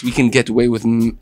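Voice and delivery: ordinary delivery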